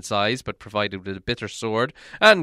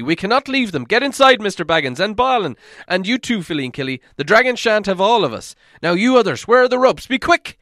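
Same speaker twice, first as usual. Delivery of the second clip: higher and louder, urgent; commanding orders; 7 semitones higher, 6 dB louder, faster than usual. Acted. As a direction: take charge and drive them on. Thorin giving urgent orders.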